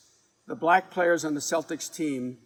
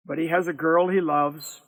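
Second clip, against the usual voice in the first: ordinary delivery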